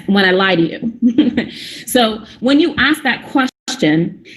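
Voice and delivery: ordinary delivery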